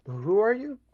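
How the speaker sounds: high, far guard challenge; suspicious guard. Acted. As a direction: bark it like a watchman.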